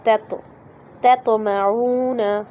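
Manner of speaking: "तत्वमऊना" drawn out slowly, first t thin, second thick; correct slow recitation. Shown as a demonstration. Teacher modelling the Quran word for students, slow careful recitation. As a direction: recite "तत्वमऊना" slowly, light t then heavy bounced t. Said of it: "ऐसे पढ़ना है इसको".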